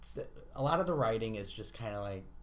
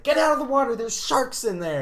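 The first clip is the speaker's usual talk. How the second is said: much higher and louder, hammed-up warning; mock lifeguard; 13 semitones higher, 11 dB louder than usual. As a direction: shriek the warning like a cheesy actress. Voicing lifeguard in porn clip.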